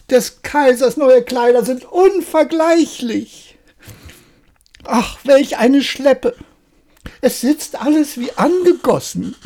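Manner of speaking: high excited acclaim; crowd cheering. Acted.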